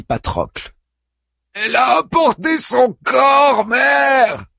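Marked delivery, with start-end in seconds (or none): 1.5-4.5 high, loud anguished outcry; grieving hero shouting at his mother; inconsolable cry. Acted. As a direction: cry it out to your mother in despair. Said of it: "s'écria Achille inconsolable".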